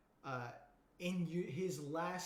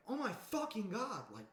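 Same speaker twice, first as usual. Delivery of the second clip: amazed heirs; 7 semitones higher than usual. Retold or imitated. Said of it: "And they're like".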